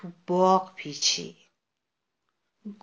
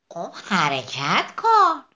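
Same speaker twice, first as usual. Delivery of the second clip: slow, louder urging bird; encouraging bird; 5 dB louder, much slower than usual. Acted. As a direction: coax it slowly to move. Bird urges sunflower.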